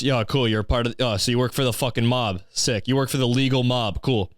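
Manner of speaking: ordinary talk, nothing marked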